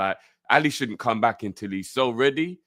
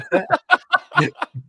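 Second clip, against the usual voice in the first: high laugh; 7 dB louder than usual. Real, not acted. Laughing at overcooked chicken joke.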